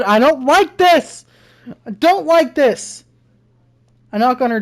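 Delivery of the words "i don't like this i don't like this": rising, louder frightened cry; scared and getting higher, slow at the end; real fear. Real, not acted. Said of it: "I'm scared".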